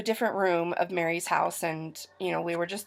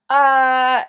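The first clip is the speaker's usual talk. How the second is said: loud, drawn-out "uh", pitched up; retold scared reaction; 6 semitones higher, 11 dB louder than usual. Telling of a scary phone call, mock scared. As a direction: blurt a higher, startled filler. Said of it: "I was scared".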